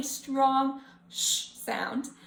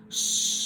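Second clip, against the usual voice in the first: playful wind-like Sh; silly sh sound. Shown as a demonstration. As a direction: whoosh the Sh like wind. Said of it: "I can be super silly with it".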